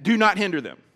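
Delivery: "do not hinder them" very loud and firm; "do not hinder them" forceful, falling at the end; emphatic command. Acted. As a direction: hammer home "do not hinder them" as an order. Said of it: "It's emphatic".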